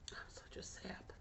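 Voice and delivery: breathy whispered aside to herself; sheepish whispered afterthought. Real, not acted. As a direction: whisper it under your breath.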